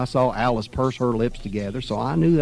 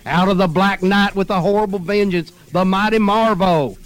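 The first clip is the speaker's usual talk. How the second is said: comic-book announcer; 7 semitones higher, 5 dB louder than usual. A narrator's reading. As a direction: proclaim it like a comic hero. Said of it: "Amazing Comics".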